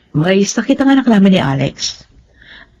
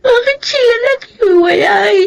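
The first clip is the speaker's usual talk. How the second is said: put-on tearful child; 9 semitones higher than usual. Child asks why parents separated.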